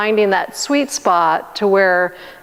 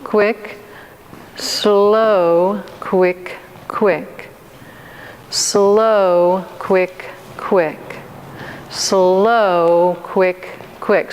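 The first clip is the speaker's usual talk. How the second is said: drawn-out slow count; much slower than usual. Shown as a demonstration. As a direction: stretch each "Slow" long, then clip "quick, quick" short. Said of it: "say it in a way that it kind of draws it out".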